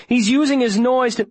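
high, urgent cry cut short; shouted warning. A narrator's reading. Viola warning Davy before being interrupted, shouting. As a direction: push the pitch right up, then break off. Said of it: "Viola shouts".

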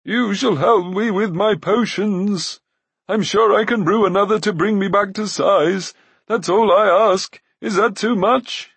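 whining high protest; crying Glue. A narrator's reading. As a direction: insist in a wailing voice. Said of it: "cried Glue".

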